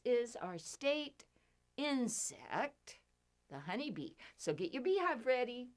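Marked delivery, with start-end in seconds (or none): none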